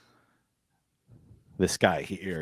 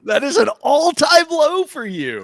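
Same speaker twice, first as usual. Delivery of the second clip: amused disbelief; 12 dB louder than usual. Real, not acted. GM reacts to bad roll.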